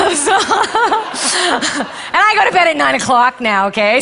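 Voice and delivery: high, loud laugh spilling into slow words; real laughter breaking through the words. Real, not acted. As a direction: laugh at yourself through the line. Laughing at her own age.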